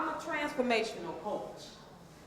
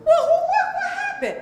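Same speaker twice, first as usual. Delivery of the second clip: mock surprise; 10 dB louder than usual. Mock confusion at sudden trouble.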